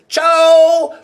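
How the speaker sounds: short musical "ciao" with a rising lilt; Osaka ciao. Shown as a demonstration. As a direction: say "ciao" like the Osaka chow, bright and quick. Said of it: "which means no or not or different".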